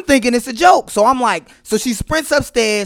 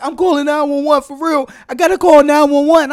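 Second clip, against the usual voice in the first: higher, urgent mock-panicked voice; imitated panic; 6 semitones higher, 3 dB louder than usual. Husband imitating his wife threatening an emergency call, high mock panic. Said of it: "panicking around the house".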